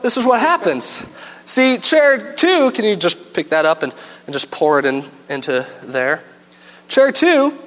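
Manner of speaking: ordinary talk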